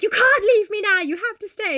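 high, loud mock desperation; hammed-up clingy begging; desperate plea. Kathy pulling Edgar back, mock desperate plea.

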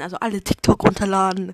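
usual speaking voice

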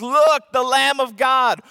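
loud, slow cry of Look; proclaiming. Retold or imitated. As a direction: announce it to the whole crowd. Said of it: "He says".

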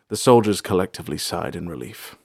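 usual speaking voice

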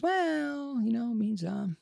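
high, drawn-out evasive "well"; hedging doctor. Retold or imitated. Doctor stalling before bad lymph node news, hedging. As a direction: stall in a high singsong, avoiding the answer.

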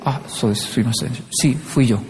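low, soft, humble admission; meek confession. Retold or imitated. Japanese person asked about a fault, meek quiet confession. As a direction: own up quietly, voice dropped.

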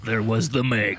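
low, portentous prologue; hammed-up epic narration; mock trailer narrator. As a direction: intone it deep and grave.

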